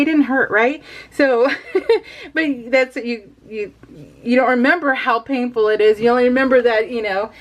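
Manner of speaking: ordinary delivery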